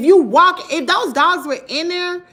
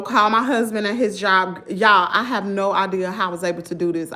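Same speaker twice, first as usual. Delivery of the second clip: usual speaking voice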